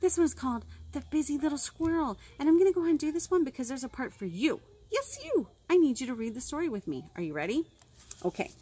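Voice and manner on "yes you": ordinary delivery, nothing marked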